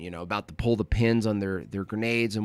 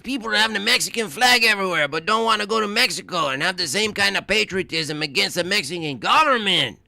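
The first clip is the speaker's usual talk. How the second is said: lightly put-on Mexican accent, a bit higher and louder; 10 semitones higher, 5 dB louder than usual. Shown as a demonstration. Streamer reading a chat comment aloud, lightly put-on Mexican accent. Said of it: "can I read it in a Mexican voice".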